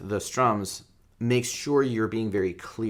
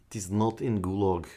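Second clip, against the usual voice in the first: put-on Russian accent; 2 semitones lower, 4 dB quieter than usual. Guitar teacher jokes the two is not missing, Russian accent. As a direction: play the joke Russian, deadpan. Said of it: "That is Russian accent".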